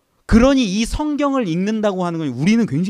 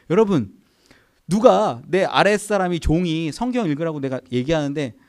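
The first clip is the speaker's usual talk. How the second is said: normal talking voice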